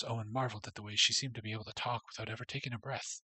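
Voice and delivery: normal talking voice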